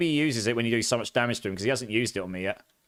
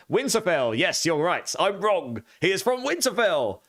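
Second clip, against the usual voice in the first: excited correction; 7 semitones higher, slower than usual. Real, not acted. To chat, realizing he got it wrong: excited. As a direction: perk up, admitting the mistake.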